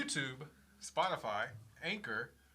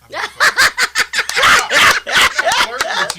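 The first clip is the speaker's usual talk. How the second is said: high, loud laughing protest; laughing denial; 15 dB louder, slower than usual. Real, not acted. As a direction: squeal it out through laughter. Denies flirting, laughing.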